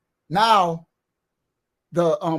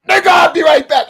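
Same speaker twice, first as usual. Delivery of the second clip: much louder, higher yell; retold yell, high and loud; shouted threat; 10 semitones higher, 13 dB louder than usual. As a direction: shout it as you run off. Yelling while running off.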